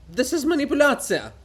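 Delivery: high, loud accusing cry; pompous writer outraged; indignant outburst. Acted. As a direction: fling the accusation at him. Bulgakov accuses Hohol.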